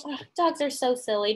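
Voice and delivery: ordinary delivery, nothing marked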